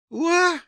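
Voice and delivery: high-pitched imitated newborn cry; baby's squeal. A narrator's reading. Baby cries in the dust at his feet, squeal. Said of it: "послышался у его ног слабый писк".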